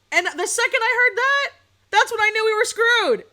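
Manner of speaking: high, loud exasperated rant. Real, not acted. Streamer ranting to chat about the boycott, loud and high. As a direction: blast out the disbelief, high and loud.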